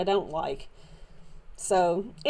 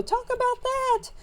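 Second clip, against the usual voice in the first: very high-pitched playful exclamation; high, held-up mock amazement; imagined viewer reaction. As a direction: mimic an audience marvelling in a high put-on voice. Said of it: "sit back and go".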